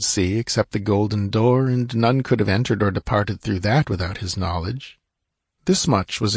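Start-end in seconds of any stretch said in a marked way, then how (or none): none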